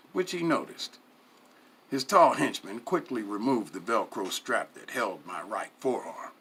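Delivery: ordinary delivery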